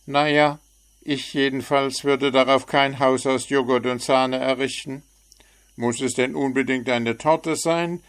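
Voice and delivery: a chirpy bird poking fun; cheeky little bird. A narrator's reading. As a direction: frecher kleiner Vogel.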